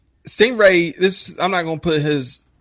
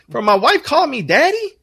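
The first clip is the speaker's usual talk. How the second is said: mock kid outrage. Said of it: "mocking the kids again".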